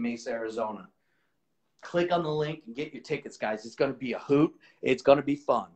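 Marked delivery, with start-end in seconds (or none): none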